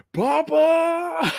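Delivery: high, loud mock yell of Papa; imitating a villain's shrill cry of Papa. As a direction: belt out Papa like a desperate villain. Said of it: "he's just yelling".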